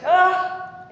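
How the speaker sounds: loud, high mock "ah"; imitated exasperated cry. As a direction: burst out as a thwarted player. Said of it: "and he's like".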